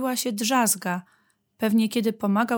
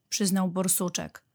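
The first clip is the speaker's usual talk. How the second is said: ordinary delivery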